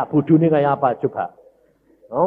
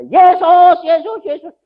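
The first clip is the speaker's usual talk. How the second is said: calling out; 12 dB louder than usual. Retold or imitated. Mother keeps calling out.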